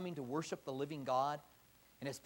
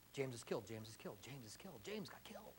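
much softer, passing the news in hushed voices; hushed rumor; 6 semitones lower, 14 dB quieter than usual. Retold or imitated. Believers passing word of James's death, hushed and soft. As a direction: murmur the bad news quickly, almost under the breath.

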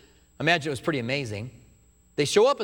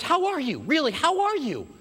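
much higher, drawn-out, insistent friendliness; over-eager greeting; 12 semitones higher than usual. Acted. Joseph greeting his scared brothers with exaggerated warmth. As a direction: pile on the friendly concern.

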